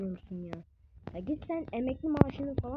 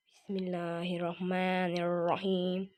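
murmured prayer; 6 semitones lower, slower than usual. Real, not acted. Prayer before danger.

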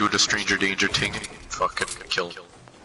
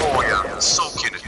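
ordinary delivery

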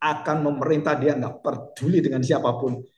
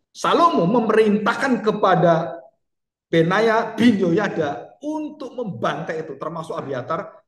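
slow forceful preaching; 5 semitones higher, 4 dB louder, slower than usual. Acted.

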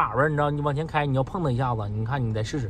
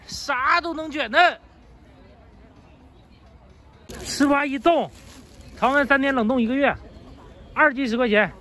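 street vendor's sales call; 7 semitones higher than usual. Real, not acted. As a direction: sing out the pitch to passers-by.

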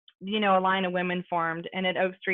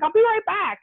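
higher, louder, excited call; 12 semitones higher, 7 dB louder than usual. Retold or imitated. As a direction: blurt it out excitedly as if dashing off. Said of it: "She was excited".

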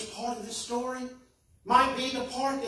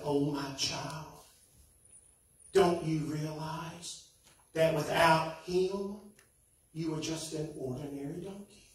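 tender mother; 5 semitones lower, 5 dB quieter than usual. A narrator's reading. Mother donkey gently telling her son the truth. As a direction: soothe a hurt child softly.

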